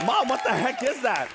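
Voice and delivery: high, incredulous outburst; shocked. Retold or imitated. Child seeing the blazer mom bought, high-pitched shock. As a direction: squawk it in high disbelief. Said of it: "I was so shocked".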